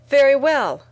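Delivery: a woman hitting back at her doubting boss; angry retort. A narrator's reading. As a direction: snap back in anger. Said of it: "I said angrily".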